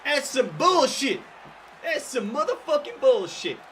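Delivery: loud, high, slow furious outburst; angry shout. Real, not acted. Ranting to the lads about working full days, angry shout. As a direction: yell it like you're fed up.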